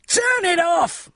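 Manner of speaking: high, loud, drawn-out yell. Acted. Neighbour at upstairs window, slow high yell. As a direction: shout it down from a window. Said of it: "somebody yelled from an upstairs window".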